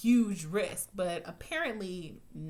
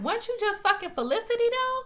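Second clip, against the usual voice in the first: high, loud and fast disbelief; shocked call-out, voice shooting up; incredulous outburst; 14 semitones higher, 5 dB louder, faster than usual. Retold or imitated. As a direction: fire it at her like you can't believe it. Ranting at character on show.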